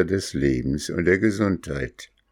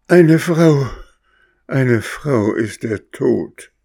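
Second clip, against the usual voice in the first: much higher and louder shrieking cry of the dying witch; witch's dying cry; 8 semitones higher, 6 dB louder, slower than usual. Acted. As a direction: cry out in horror, then slower. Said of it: "und rief". (Hexe, entsetzt aufschreiend)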